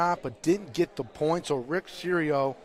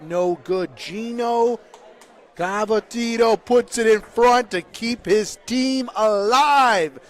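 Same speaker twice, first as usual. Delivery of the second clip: loud, raised, excited call; excited play-by-play; 5 semitones higher, 7 dB louder than usual. Real, not acted. Commentator as Gino keeps his team alive, excited. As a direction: build the drama as the shot is decided. Calling a decisive championship shot.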